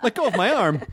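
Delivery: laughing yelp, arm held in a playful grip; half-laughing protest at a twisted arm; arm twisted back. Pretend. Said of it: "you're hurting me".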